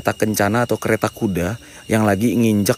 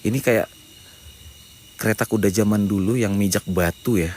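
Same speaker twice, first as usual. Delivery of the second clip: usual speaking voice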